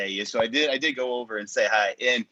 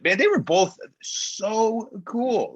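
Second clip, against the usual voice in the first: starstruck; 9 semitones higher, much slower than usual. Real, not acted. Starstruck over meeting band.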